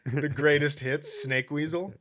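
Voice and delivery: normal talking voice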